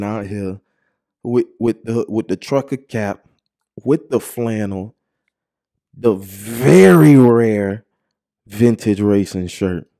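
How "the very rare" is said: drawn-out, slow stressed very rare; savored emphasis. Real, not acted. Describing the rare vintage shirt, slow savored stress. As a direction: relish each word.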